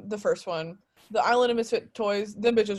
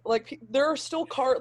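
usual speaking voice

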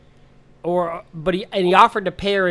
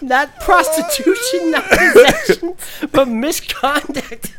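howling with laughter; laughing shout; 10 semitones higher than usual. Real, not acted.

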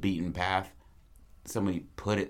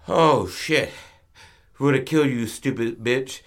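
high, loud burst of relief and scolding; acted shock, loud then easing off; startled outburst; 5 semitones higher, 8 dB louder than usual. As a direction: blurt it out loudly, then scold. Startled greasers scold the dog.

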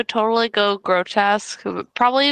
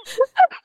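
squealing laugh; sudden high laugh; high burst of laughter. Real, not acted. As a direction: break into a high giggle. Laughing at strap-on image.

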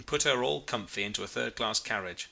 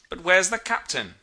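higher, louder puzzled question; sudden concern; 7 semitones higher, 5 dB louder than usual. A narrator's reading. Mate suddenly missing the captain, raised concerned question. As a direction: realise someone is missing.

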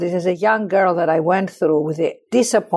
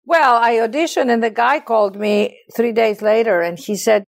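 usual speaking voice

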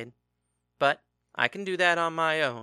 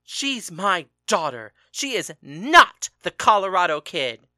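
loud, raised furious tirade; angry shouting; 8 dB louder than usual. A narrator's reading. Father shouting at husband about training their daughter, angry. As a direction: hammer each accusation home.

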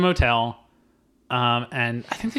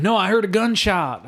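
slow, high-pitched movie-cowboy impersonation; put-on actor impression, insistent; John Travolta; 8 semitones higher, 3 dB louder than usual. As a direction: drawl it like a cowboy film star. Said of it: "just started doing Urban Cowboy Travolta".

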